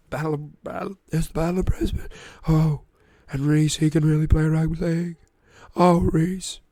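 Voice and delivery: hammed-up presidential impression; Donald Trump. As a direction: admire him in mock American politician style. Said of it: "Donald Trump's favourite player would be".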